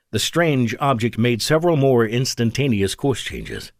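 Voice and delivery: plain reading voice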